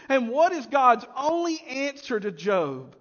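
ordinary talk

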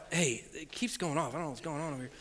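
low, hushed message; quiet worry. Retold or imitated. Patient texting his wife about alarms, quiet worry. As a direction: confide it softly and low.

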